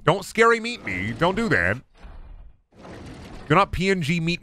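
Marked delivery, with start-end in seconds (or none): 0.0-1.8 whiny complaint. Real, not acted. Scolding game after jump scare.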